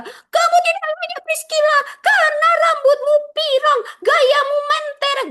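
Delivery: very high, loud sneering mimicry; mocking judgmental voice. Preacher mocking a jealous pastor scolding Priscilla, high shrill sneer. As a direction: squeal it like a snide gossip looking down on her. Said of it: "saya mau tegur kamu yang merasa".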